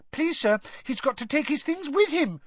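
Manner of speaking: put-on high squeaky boy voice. A narrator's reading. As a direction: squeak it out eagerly. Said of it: "squeaked Colin".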